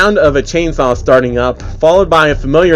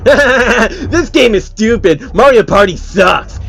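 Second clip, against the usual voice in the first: put-on high, slow, louder whiny man-child; whiny tantrum; 7 semitones higher, 3 dB louder, slower than usual. Phil's tantrum quoted.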